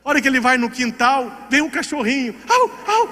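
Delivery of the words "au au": dog barking. Retold or imitated.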